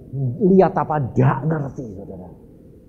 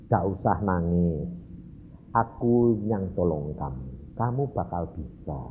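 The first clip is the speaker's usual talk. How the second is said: low, soft, unhurried reassurance; gently calm someone who is crying; quiet, steadying promise; comforting God; 10 semitones lower, 8 dB quieter than usual. Acted. God comforting him, voiced by speaker.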